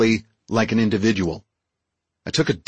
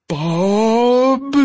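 high, loud, drawn-out squeal; excited squeak; 13 semitones higher, 5 dB louder than usual. A narrator's reading. Deltan greets his friend, high excited squeak. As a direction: squeak the name out loudly. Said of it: "he squeaked".

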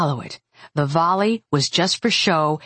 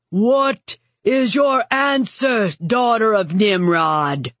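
slow, drawn-out challenge from a warlord; rolling battlefield voice; 5 semitones higher, slower than usual. A narrator's reading. Warlord demanding an answer of Nimrod's daughter, slow rolling challenge. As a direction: carry each word across the field. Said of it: "Naeg's voice rolled through the battlefield".

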